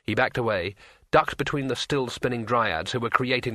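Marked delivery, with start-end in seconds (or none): none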